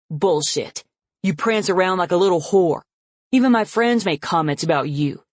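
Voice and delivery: loud, sneering tirade; father raging at his daughter with contempt; angry contempt. Acted. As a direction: spit the insults at her.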